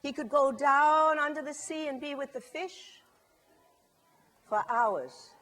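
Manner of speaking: ordinary delivery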